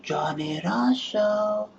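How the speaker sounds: high, very slow sing-song call; woman calling her man in for dinner; woman calling out the window. Shown as a demonstration. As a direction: call him in for dinner, drawn out. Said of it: "Shouting out the window".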